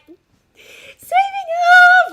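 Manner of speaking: mocking the always-smiling act; mock cheery sing-song. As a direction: chirp it like a grinning TV host. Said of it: "you expect me to be like".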